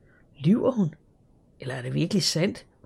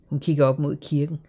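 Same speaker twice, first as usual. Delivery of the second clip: usual speaking voice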